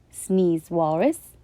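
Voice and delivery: plain reading voice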